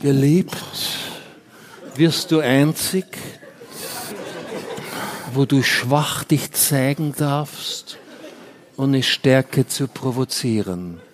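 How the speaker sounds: slow, low reading of an aphorism; solemn recitation. Acted. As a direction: recite it with weight. Recites Adorno quote.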